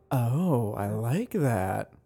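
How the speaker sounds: slow soft pleased murmur; a quiet, appreciative tasting voice; slow quiet savoring. Retold or imitated. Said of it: "It's more like".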